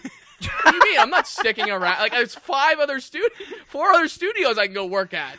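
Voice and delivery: high, loud, laughing mock rant. Acting Nolan quitting, loud.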